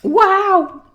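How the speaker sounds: amazed wow. Real, not acted. Amazed after release.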